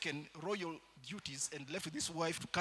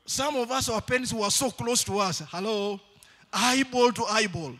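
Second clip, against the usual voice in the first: loud, high sermon cry to the church; preaching at full force; 5 semitones higher, 9 dB louder than usual. Acted. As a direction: preach it out, pushing every word.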